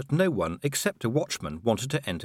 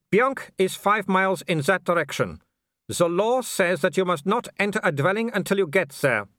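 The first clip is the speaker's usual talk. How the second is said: put-on higher-pitched voice with a Germanic accent; haughty werewolf leader; 9 semitones higher, 3 dB louder than usual. Werewolf explains the game to his quarry, haughty. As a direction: play a foreign villain laying down terms.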